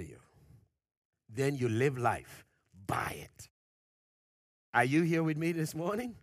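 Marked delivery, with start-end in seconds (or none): none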